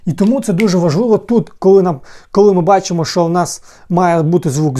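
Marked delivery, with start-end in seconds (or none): none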